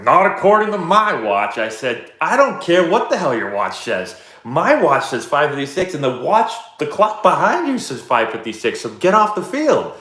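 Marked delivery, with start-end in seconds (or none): 0.0-1.6 smug coach. Retold or imitated. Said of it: "the guy was like". 2.2-9.9 retold angry rant, higher and a little louder; irate. Coach arguing with the lacrosse coach over field time, heated. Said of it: "I was irate".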